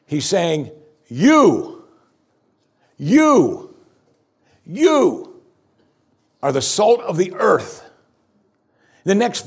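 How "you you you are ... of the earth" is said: each "You" rising higher and louder, then "salt" landing; emphatic proclamation. Acted. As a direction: build "you, you, you" into a ringing climax. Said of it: "it's emphatic in the Greek".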